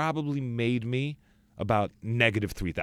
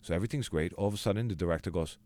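ordinary talk, nothing marked

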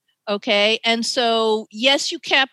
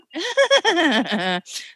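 laugh; 6 semitones higher than usual. Real, not acted. Laughs at nervous memory.

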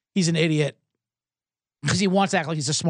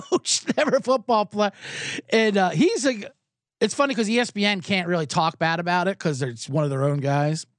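ordinary delivery

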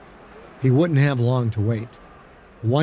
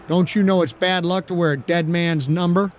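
higher, taunting voice of a cocky rival; sneering quarterback taunt, voiced higher; mocking quarterback; 5 semitones higher than usual. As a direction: needle him about the dead man's number.

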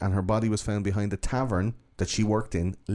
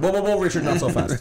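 high, louder 'hold on' call; mock halting call; 9 semitones higher, 4 dB louder than usual. Police lineup stopping an overeager Richard, mock halting call. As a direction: rein in someone too eager, playfully.

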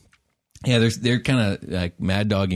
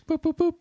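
high chirpy boop boop ringing; phone ringing noise. Pretend.

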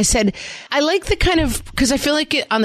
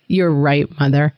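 lower, stilted formal apology; mock contrite child; 4 semitones lower than usual. Imagined child apologizing formally to mother, mock-solemn. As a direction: recite a hammed-up formal apology. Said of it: "it's not like they're like".

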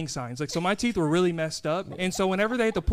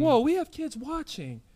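high, drawn-out playful warning; slow, high-pitched mock scandal; mock alarm; 7 semitones higher, slower than usual. Playful alarm about spoiling Santa.

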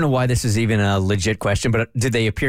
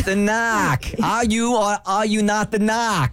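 put-on slow, high Boston accent; drawn-out Boston accent; 9 semitones higher, slower than usual. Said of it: "I'm really bad at accents".

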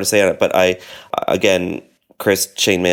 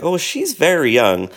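retold surprise; 9 semitones higher, slower than usual. Retold reaction to actress's age.

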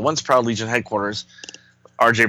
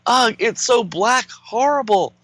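panicked hero voice; 10 semitones higher than usual. Acted. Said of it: "And Sun Boy's like".

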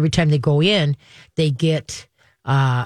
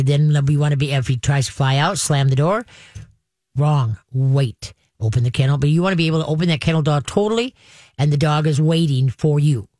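normal talking voice

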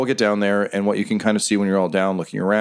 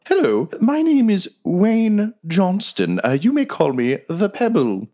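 high, slow, genteel squeak; a courteous creature introducing itself; polite badger; 11 semitones higher, slower than usual. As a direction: badger introducing himself to Quinny, high and proper. GM voices the badger.

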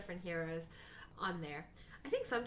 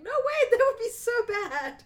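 laughing disbelief; 12 dB louder than usual. Retold or imitated. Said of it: "And I'm like".

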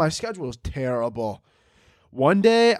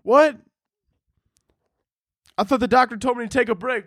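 much higher, startled questioning; surprised; 9 semitones higher than usual. Pretend. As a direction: caught off guard by God's order. Talking back to God.

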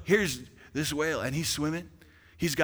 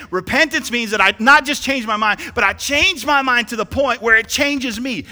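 higher and louder, preached hard; louder restatement; 9 semitones higher, 11 dB louder than usual. Shown as a demonstration. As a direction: say it again with force. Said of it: "Let me say that again".

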